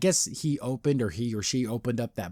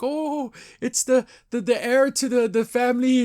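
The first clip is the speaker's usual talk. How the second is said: put-on crazy old lady; 10 semitones higher than usual. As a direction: imitate a fussing old lady, pitched up. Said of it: "these two crazy old ladies".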